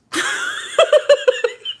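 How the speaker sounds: high, loud burst of real laughter; uncontrolled laughter. Real, not acted. Host cracking up over a listener's letter, helpless laugh. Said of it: "I should not be laughing".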